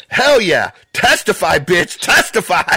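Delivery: high, loud cheering shout; triumphant yell. Retold or imitated. Husband cheering at the TV from the living room, a loud high triumphant shout. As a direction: cheer like he just won a championship. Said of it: "with my hands in the air like".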